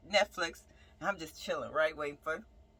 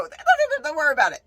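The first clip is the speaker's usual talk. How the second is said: frantic reassurance; 8 semitones higher, 7 dB louder, faster than usual. Retold or imitated. Reassuring her worried brother.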